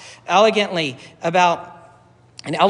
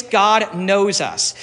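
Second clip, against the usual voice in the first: slow, weighty stress on God; slow emphatic; much slower than usual. Real, not acted. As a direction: let each word land slowly. Slow emphatic preaching line.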